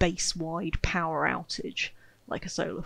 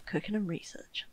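"cooking and research" whispered softly under her breath; 8 dB quieter than usual. Shown as a demonstration. Streamer muttering her colony priorities to herself, soft whisper.

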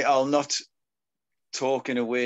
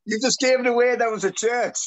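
high, loud mock-reproach; amused outburst; 10 semitones higher, 4 dB louder than usual. Real, not acted. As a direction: blurt it out, much higher and louder. Gives away the secret, both laughing.

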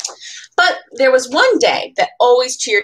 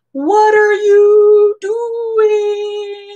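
much higher, very slow, drawn-out ghostly call; worried ghost friend; 11 semitones higher, 6 dB louder, much slower than usual. Acted. Ghost in the tree calling to the leaving friend, slow high wail. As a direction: stretch it out like a wailing ghost.

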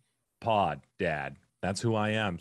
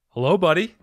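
usual speaking voice

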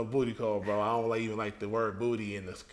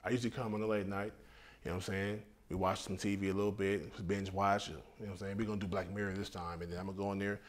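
low, soft, offhand rundown; casual bragging; 5 semitones lower, 8 dB quieter than usual. Acted. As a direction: lay out the routine low and laid-back. Casual rival lays out his routine.

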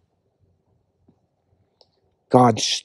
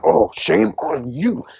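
mock scolding; 4 semitones higher, 3 dB quieter, slower than usual. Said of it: "People are like".

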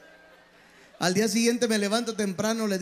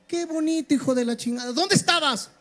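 high, loud scolding wife; esposa regañona; 7 semitones higher, 3 dB louder than usual. Acted. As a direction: scold him in a raised, high-pitched voice. Esposa regaña al marido borracho. (regaño de esposa en voz aguda)

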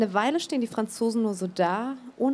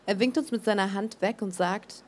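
usual speaking voice

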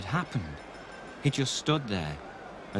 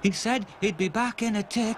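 put-on young Stanley; 10 semitones higher than usual. Stanley telling the crowd where Stopper went, childlike. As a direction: answer simply like a small boy. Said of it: "said Stanley".

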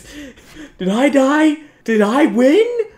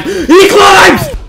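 much higher, loud panicked yell; high panicked shout; 12 semitones higher, 8 dB louder than usual. Real, not acted. Player spotting a zombie climb, panicked shout.

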